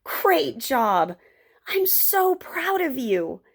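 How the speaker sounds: high, slow, heartfelt praise; smiling, proud boy. Acted. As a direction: gush with pride through a smile.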